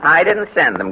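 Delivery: ordinary talk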